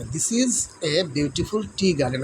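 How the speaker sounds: slow, word by word, each word set apart; slow dictation. Shown as a demonstration. Teacher repeats the answer slowly for pupils. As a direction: spell the sentence out for the class. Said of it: "এটা হবে সেন্টেন্সটি হবে".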